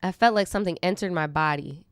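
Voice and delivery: normal talking voice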